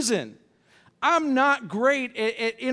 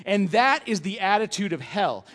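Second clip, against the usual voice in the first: usual speaking voice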